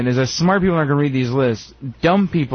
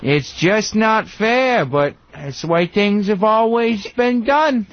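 mock whine; 5 semitones higher, slower than usual. Slow mock whiny worker.

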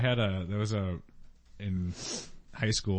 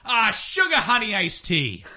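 high, slow, passionate fake curse; passionate mom exclaim; 9 semitones higher, 4 dB louder than usual. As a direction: belt it like a keen team mom. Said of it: "with passion".

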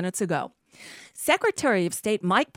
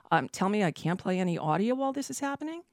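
ordinary talk

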